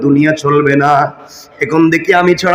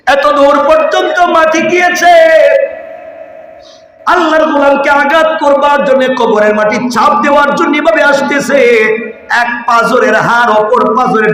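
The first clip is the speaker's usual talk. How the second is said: high, ringing sermon cry; full-force preaching; 10 semitones higher than usual. Acted. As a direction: preach it out in a raised, drawn voice.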